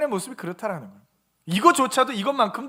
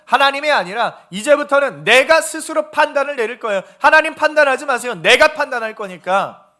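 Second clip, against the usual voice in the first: loud, insistent mock declaration; acted defiance; 2 semitones higher, 9 dB louder than usual. Sinner telling God he will judge, loudly defiant.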